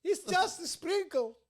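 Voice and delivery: very high, slow, put-on voice; exaggerated high-pitched joke line; squeaky comic voice. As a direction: stretch it out in a silly falsetto.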